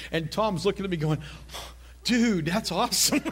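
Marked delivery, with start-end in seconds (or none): 2.0-3.2 lightly imitated awestruck buddy, drawn out; a touch of slow, wowed disbelief; amazed friend. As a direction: slowly voice a friend's mild amazement. Voices his amazed friend's reaction.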